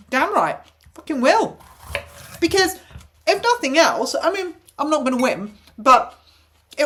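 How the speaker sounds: high, loud, fired-up defiance; indignant outburst. Real, not acted. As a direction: spit it out in righteous anger. Ranting about the insurer.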